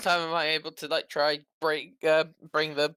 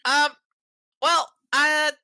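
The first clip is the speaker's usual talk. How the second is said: raised in-character cleric voice; 11 semitones higher, 6 dB louder than usual. Acted.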